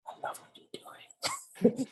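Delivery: hushed secretive whisper. Retold or imitated. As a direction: whisper it so no one overhears. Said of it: "Why are you talking so low?".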